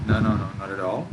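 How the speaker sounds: ordinary talk, nothing marked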